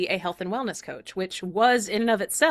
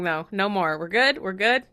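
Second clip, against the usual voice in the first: ordinary talk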